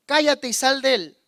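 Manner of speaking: firm command to an evil spirit; authoritative rebuke. Shown as a demonstration. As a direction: command the demon firmly. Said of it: "autoridad no es levantar la voz".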